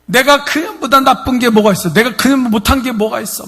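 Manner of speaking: high, loud, jealous outburst; screaming. Retold or imitated. 옆집 여자를 두고 남편에게 소리치는 아내. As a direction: scream at the cheating husband. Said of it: "죽을 듯이 막 외치는".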